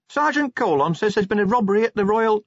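high-pitched junior officer voice; small high character voice; Cheery. A narrator's reading. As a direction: pitch it well up, deferential. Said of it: "Cheery began".

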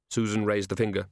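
usual speaking voice

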